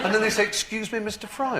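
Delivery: high mock-indignant quoting; Nicholas Lyndhurst. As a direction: parrot their politeness in a high, aggrieved voice. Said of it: "Nicholas was saying".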